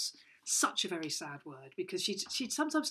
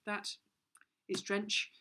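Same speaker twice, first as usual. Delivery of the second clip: usual speaking voice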